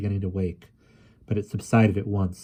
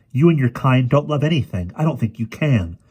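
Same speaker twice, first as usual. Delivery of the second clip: raised, heated voice, high and loud; angry accusation; 5 semitones higher, 6 dB louder than usual. A narrator's reading. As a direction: accuse with indignation, easing slightly at the end. Ariel quarrels with the witch.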